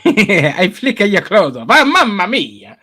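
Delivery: loud, slow, high-pitched cartoon Italian; mock Italian accent. Mocking an actor's accent to co-hosts, hammed-up Italian accent. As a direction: ham up a singsong Italian accent, drawn out. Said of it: "did a better fucking Italian accent than Mario".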